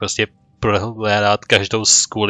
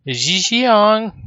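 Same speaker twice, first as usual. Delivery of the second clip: high, loud call across the cave; 11 semitones higher, 3 dB louder than usual. Acted. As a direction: call her name to find her. Calling for a missing companion.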